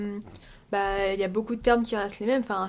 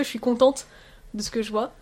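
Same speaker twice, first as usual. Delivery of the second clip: ordinary delivery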